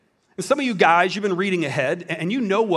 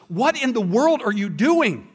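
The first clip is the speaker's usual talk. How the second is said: higher, slower woken-up wife; sleepy wife catching him, drawn out; startled wife; 5 semitones higher, slower than usual. Retold or imitated. Wife woken at night, startled and slow. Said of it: "his wife woke up and she goes".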